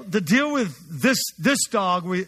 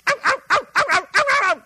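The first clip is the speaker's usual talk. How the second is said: high yappy puppy yelps, much louder; excited puppy barking; 6 dB louder, much faster than usual. Retold or imitated. Preacher mimics puppy yapping for food, high and loud. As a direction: bark out like an excited little puppy at feeding time. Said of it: "like, excited and barking".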